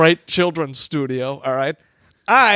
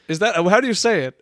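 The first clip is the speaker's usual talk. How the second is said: usual speaking voice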